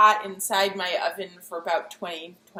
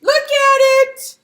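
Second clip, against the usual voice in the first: excited; 11 dB louder, slower than usual. Real, not acted. Proud reveal of the baked bread.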